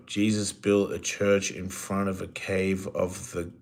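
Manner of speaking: ordinary talk, nothing marked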